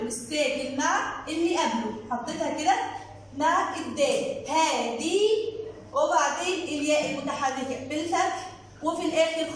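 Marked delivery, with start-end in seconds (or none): none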